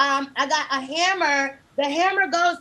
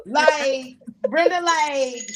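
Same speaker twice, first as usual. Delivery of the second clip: ordinary talk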